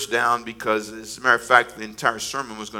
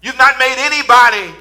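higher, louder preaching cadence; full preaching; 9 semitones higher, 11 dB louder than usual. Acted. Pastor preaches to the congregation in a lifting cadence. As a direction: preach it with rising conviction.

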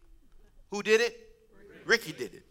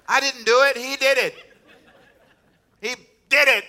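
high, loud protest of innocence; comic, hammed-up denial; defensive blame-shifting; 8 semitones higher, 9 dB louder than usual. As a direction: push the blame away loudly. Acts out shifting blame to Ricky.

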